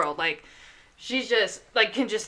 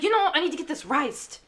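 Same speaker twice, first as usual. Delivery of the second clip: raised-pitch imitation of a cross grandma; irritated grandma; 8 semitones higher, faster than usual. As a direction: insist as a grandma who isn't being understood. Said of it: "Like, she got mad".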